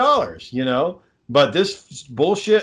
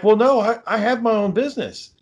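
imitating the lady; 6 semitones higher than usual. Garden center lady voiced in retelling.

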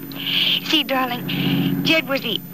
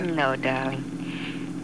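soft, slow and low, lingering on darling; a drawn-out loving reply, barely above a murmur; tender reassurance; 8 semitones lower, 6 dB quieter, much slower than usual. Acted. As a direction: soothe her gently.

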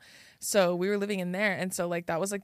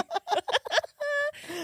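high laugh. Real, not acted.

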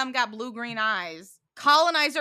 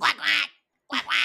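higher imitation quacks; duck quacking; 4 semitones higher, 3 dB louder than usual. Imitating Mandy in the duck mask, quacking. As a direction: quack in quick pairs like a duck. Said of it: "starts quacking in everyone's faces".

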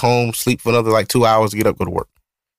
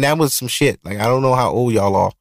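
normal talking voice

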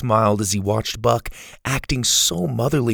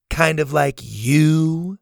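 sassy; 5 semitones higher, much slower than usual. A narrator's reading. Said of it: "Zeta said in a sassy tone".

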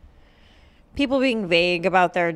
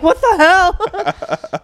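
high, drawn-out goofy dolt; dim-witted movie clone; 9 semitones higher, 5 dB louder, much slower than usual. Acted. Imitating the dumbest movie clone for laughs, dopey. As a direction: play a dopey clone, loud and slow. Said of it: "it keeps getting dumber".

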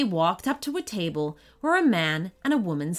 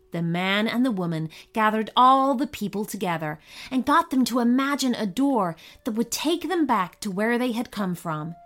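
plain reading voice

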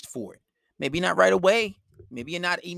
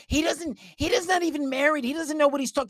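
high, dismissive whine; mock the speaker as a girlfriend would; mock scornful girlfriend; 9 semitones higher than usual. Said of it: "You're like".

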